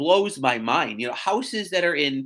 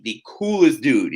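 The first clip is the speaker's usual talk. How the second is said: ordinary talk, nothing marked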